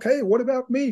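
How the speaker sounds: high, mock-whiny protest; half-joking high-pitched "what about me"; mock plea. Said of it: "And I'm like".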